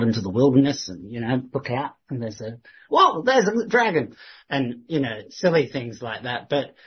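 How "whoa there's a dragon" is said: mock surprise. Mocking a retold vision.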